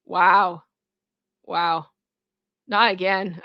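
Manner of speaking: lightly imitated rapper's track opening; unimpressed, with gaps between the words. Streamer quoting a diss track opening, mumbly imitation. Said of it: "when he's doing his diss track".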